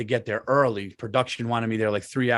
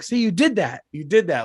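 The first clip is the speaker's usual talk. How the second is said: excited praise; 5 semitones higher, 4 dB louder than usual. Retold or imitated. Dad praising daughter.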